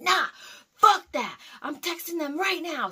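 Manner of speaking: high, loud defiant refusal; retold flipping out. Driver refuses coworker's calming words, angry shouting. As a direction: act out an angry outburst, cutting off the comfort. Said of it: "I was flipping out".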